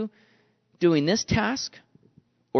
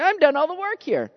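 resentful complaint; 10 semitones higher than usual. Retold or imitated. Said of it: "I'm sitting here".

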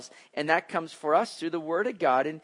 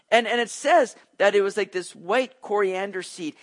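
ordinary talk, nothing marked

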